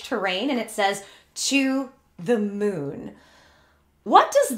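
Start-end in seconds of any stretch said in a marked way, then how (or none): 1.3-3.2 dramatic slow read. Pretend. Vlogger reads the tweet aloud with slow mock drama. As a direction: stretch each word out, slow and grand.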